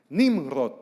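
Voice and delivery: proud loud Nimrod; mock father's answer. Father answers the interpreter, loudly proud. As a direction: blurt the name out loud. Father names his son to pastor.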